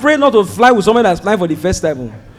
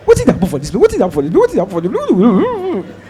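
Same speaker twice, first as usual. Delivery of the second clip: Pidgin muttering; 5 dB quieter, faster than usual. Retold or imitated. Mimicking passengers' muttered complaint.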